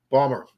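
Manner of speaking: "Balmer" with the T and the R dropped, two syllables; "Balmer", said the way locals say Baltimore. Shown as a demonstration. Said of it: "which is how we say it up there".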